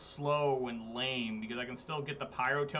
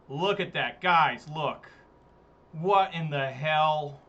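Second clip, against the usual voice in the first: louder, stunned, slowing down on the last words; loud shocked disbelief; 8 dB louder, much slower than usual. Real, not acted. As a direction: call the chat to look, floored. Huge life gain shocks him.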